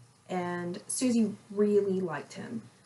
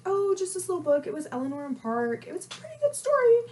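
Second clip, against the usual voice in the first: coy fast reply; 8 semitones higher, faster than usual. Retold or imitated. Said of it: "And I'm like".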